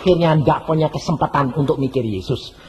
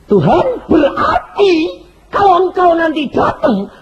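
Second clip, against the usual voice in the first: alarmed cry; 10 semitones higher, 4 dB louder than usual. Retold or imitated. Preacher's alarmed reply to God.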